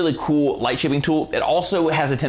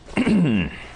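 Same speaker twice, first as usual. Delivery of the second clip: clears throat roughly; throat clearing; 3 semitones higher than usual. Real, not acted. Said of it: "as I'm losing my voice".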